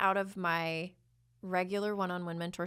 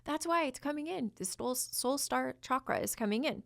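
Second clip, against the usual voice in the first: high-pitched excited quote of oneself; much higher, thrilled voice; retold realization; 7 semitones higher than usual. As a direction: re-enact a sudden excited realization. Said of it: "I was like".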